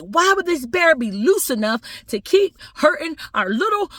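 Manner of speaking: very high hysterical protest, dropping at the end; upset, fussing family. Acted. Family accusing zookeepers over their boy, hysterically upset. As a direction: wail in mock outrage at the zookeepers. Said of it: "they were so upset".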